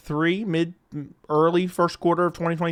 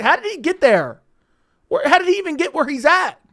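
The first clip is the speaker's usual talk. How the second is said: mocking Dana; 9 semitones higher than usual.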